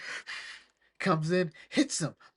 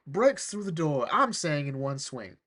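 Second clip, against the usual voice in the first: normal talking voice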